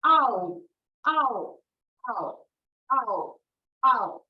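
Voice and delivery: loud repeated ow; mock pain cry. Shown as a demonstration. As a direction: yelp ow each time. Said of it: "Here you're going to print ow".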